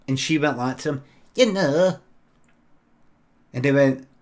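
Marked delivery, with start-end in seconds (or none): 1.4-2.0 louder, higher Blackpool woman's voice; Blackpool accent. Acted. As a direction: prompt him pointedly in a put-on Blackpool accent. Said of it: "is that a Blackpool accent you've got".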